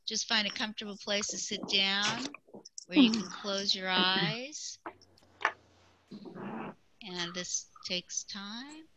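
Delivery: slow, calm meditation guide. Acted. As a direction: ease them gently into stillness. Leads a meditation.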